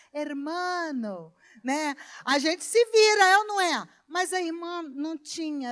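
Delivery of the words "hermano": mock Spanish. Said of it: "me virava em espanhol".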